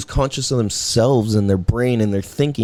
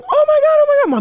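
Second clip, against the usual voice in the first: mock shriek, much higher and louder; imitated panicking teachers; 5 dB louder than usual. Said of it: "they're going".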